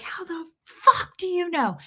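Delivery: high-pitched mock scream at moderate volume; retold scream. Lady at baby shower to mother, imitated screaming. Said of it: "she screams that at me".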